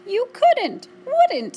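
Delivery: very high, loud mouse squeak. A narrator's reading. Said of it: "squeaked the mouse".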